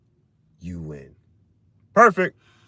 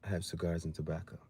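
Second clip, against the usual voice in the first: low and much softer, self-satisfied; quiet smug answer; 11 semitones lower, 14 dB quieter than usual. Retold or imitated. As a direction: murmur it low, pleased with yourself. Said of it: "They be like".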